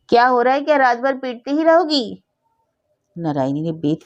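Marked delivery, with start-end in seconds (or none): 0.1-2.2 calling from inside. Acted. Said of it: "पुकार कर कहा".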